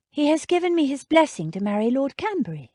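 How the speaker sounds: hurt lady voice; heroine voice. A narrator's reading. Jane tells Radcliffe.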